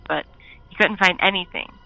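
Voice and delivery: ordinary delivery, nothing marked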